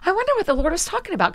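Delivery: high, puzzled wondering. Retold or imitated. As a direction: wonder aloud, baffled. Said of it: "And I was like".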